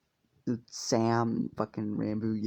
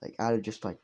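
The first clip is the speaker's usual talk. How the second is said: normal talking voice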